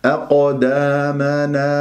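slow chanted أقدامنا, heavy ق echo; measured recitation. Shown as a demonstration. Teacher reciting for students, slow sustained madd. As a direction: hold both long aa vowels evenly. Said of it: "ما الطبيعي حركتين".

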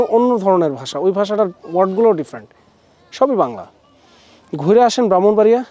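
usual speaking voice

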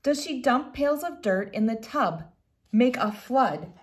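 gentle storybook reading voice; reading to children. Acted. Reads picture book aloud.

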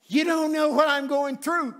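high, loud, drawn-out complaint; mock a self-pitying churchgoer slowly; imitated wailing complaint. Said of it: "sick and tired of sour Christians".

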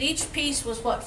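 usual speaking voice

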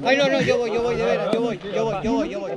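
high, soft, fading nervous repeats; nervous muttering. Real, not acted. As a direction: talk yourself into it, scared. Psyching himself up to jump.

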